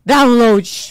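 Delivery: abrupt, very loud mock blurt; shouted nonsense reply. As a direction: blurt it out at full volume, imitating a drunk friend. Imitating friend's nonsense shouted reply. (resposta berrada de bêbada, imitando)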